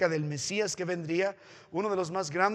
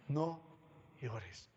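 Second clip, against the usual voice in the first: very soft, lower, gentle "no llores"; tender comfort; 5 semitones lower, 14 dB quieter than usual. Acted. Elder comforting weeping John, very softly. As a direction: hush a weeping man kindly.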